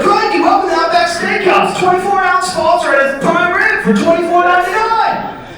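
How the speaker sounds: very high, loud hammed-up Aussie pitch; Australian ad voice. Comic spoofing a steakhouse commercial, loud high Aussie pitchman. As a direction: sell the steak like a shouting Aussie ad. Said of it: "It's like".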